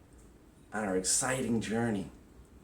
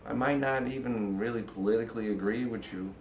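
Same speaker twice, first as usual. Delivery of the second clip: tender reassurance; 7 dB quieter than usual. Pretend. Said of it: "You'd be like".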